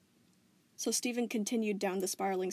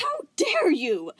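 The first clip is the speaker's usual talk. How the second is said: high, loud, slow appalled cry; shocked gasp; 10 semitones higher, 11 dB louder, much slower than usual. Acted. Volleyball appalled at Spinel's insult, shocked. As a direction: gasp it out in disbelief. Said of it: "Volleyball gasped".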